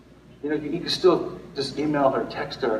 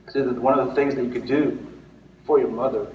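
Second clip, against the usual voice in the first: ordinary talk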